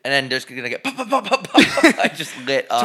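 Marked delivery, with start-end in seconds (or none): 1.0-2.1 high, loud mock gunshots through laughter; laughing gunfire pops. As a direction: pop the shots while cracking up. Imitating gunfire while laughing.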